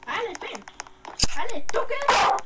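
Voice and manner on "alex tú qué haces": loud, higher-pitched demand; shouted questioning; sharp challenge. Real, not acted. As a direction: call Alex out, raised voice. Challenging Alex over the scissors.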